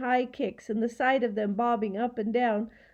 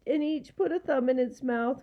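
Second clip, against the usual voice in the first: ordinary delivery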